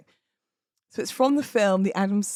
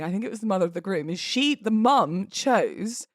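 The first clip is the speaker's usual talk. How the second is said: ordinary talk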